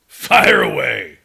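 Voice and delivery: loud, slow host call; host cue. Acted. Host cueing John to start, loud and slow. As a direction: fire it off like a game-show host.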